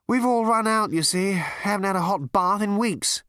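hint of genial captain. The captain speaks in story.

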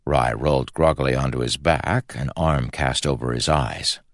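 ordinary delivery, nothing marked

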